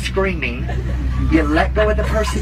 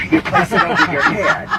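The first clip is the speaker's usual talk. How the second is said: laughing; 13 semitones higher, 4 dB louder than usual. Real, not acted. Laughing through safety demo.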